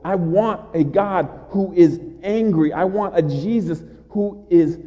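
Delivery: rising preacher's roar, pleading for an angry God; preaching at full force. Acted. Preacher to congregation, building to a loud roar.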